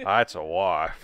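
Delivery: slow, drawn-out Aussie accent; attempted Australian accent. Shown as a demonstration. Co-host demonstrates for the amused host, slow put-on Australian accent. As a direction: stretch it out in mock Australian. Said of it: "and the accent?".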